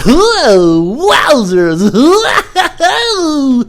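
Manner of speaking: high, loud astonished laughing whoa; laughing to himself. Retold or imitated. Alone at the roadside after the drop-off, loud high laugh. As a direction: let it burst out high with laughter. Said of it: "that's how I laughed to myself".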